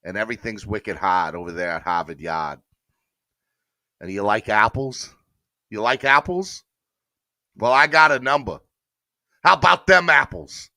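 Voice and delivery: hammed-up Boston movie-quote impression; Boston accent. Quoting Good Will Hunting to the audience, hammed-up Boston accent. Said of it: "I think Boston has the best accent".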